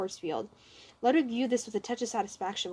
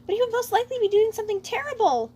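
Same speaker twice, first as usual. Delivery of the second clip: high, louder fearful troopa voice; frightened troopa voice; 12 semitones higher, 8 dB louder than usual. Acted. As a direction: voice of a nervous little Koopa. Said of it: "with a frightened inflection".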